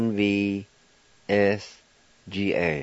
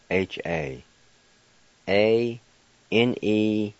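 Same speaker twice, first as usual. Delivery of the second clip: normal talking voice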